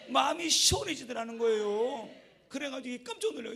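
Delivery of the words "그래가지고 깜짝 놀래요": usual speaking voice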